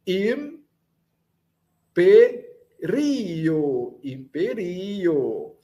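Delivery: imperio slowly split into im, pe, RI-o; long, strong RI in imperio; stress on RI. Shown as a demonstration. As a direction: pause between syllables, then lean on RI in imperio. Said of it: "é o ri".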